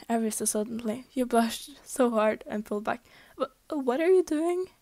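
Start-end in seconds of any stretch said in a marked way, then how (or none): 3.4-4.7 flustered stammer, higher and louder; blurted out. A narrator's reading. Blushing girl pulling back after a kiss, blurting it out. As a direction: blurt it out, tripping on the first word. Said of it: "You blurted out".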